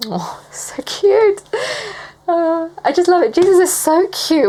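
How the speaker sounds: high-pitched adoring coo; real, giddy fondness; gushing delight. Real, not acted. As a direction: melt with affection, voice way up. Gushes over the Bible passage.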